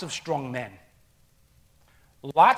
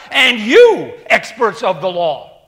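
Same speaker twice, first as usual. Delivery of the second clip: forceful scripture preaching; 9 dB louder, slower than usual. Acted. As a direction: thunder out Jesus' rebuke to the Pharisees. Preacher reads Jesus' reply.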